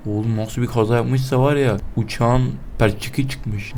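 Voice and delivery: ordinary talk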